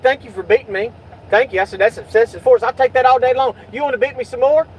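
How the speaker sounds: sarcastic. Retold or imitated. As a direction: thank them with biting sarcasm.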